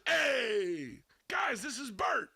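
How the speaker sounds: drawn-out, high announcement to a group; calling out. Retold or imitated. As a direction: call out high and loud to the room. Introducing Bert to the room.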